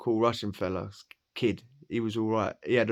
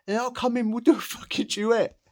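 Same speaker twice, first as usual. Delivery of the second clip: high, loud cheeky retort; cheeky comeback; 13 semitones higher, 5 dB louder than usual. Retold or imitated. New boy answers the taunts, high cocky retort. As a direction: fire it back high and cocky. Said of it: "I was like".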